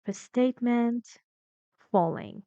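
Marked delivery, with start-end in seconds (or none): 1.9-2.4 "falling" said with a downward glide; falling intonation. Shown as a demonstration. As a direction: drop the pitch through "falling". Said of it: "the intonation was falling".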